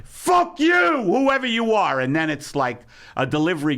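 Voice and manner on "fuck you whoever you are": shouting at a stranger. Retold or imitated. Owner shouting through the window at a delivery man. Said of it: "I'm next to Charlotte saying".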